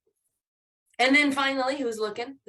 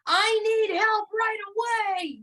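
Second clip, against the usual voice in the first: exclamation; 10 semitones higher than usual. Shown as a demonstration. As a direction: read it as an emphatic exclamation. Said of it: "put an exclamation point at the end".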